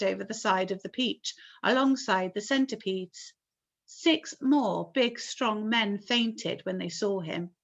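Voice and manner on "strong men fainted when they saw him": usual speaking voice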